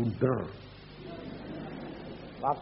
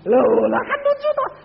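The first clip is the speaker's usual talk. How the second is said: much higher, loud, slow exclamation; amused exclaim; 10 dB louder than usual. Real, not acted. Preacher laughing at his wife's question, high and slow. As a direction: marvel at the joke.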